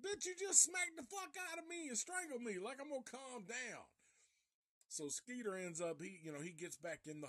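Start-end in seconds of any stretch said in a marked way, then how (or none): 0.0-3.8 incredulous mock reply. Skeeter after being strangled, high incredulous sarcasm. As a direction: squeal back at him in disbelief.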